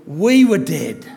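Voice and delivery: We punched, loud and slow; "We" stressed loud and drawn out. Shown as a demonstration. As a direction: lean hard on "We", then let "were dead" fall. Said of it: "he turns back to the we".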